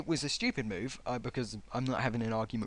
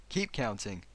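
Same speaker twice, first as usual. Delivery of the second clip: ordinary delivery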